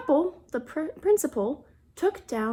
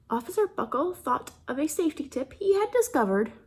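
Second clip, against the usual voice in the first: usual speaking voice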